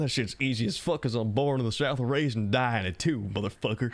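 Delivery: Southern accent. Shown as a demonstration. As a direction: lean into a broad Southern accent, slowing toward the end. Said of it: "I could do a goddamn Southern accent".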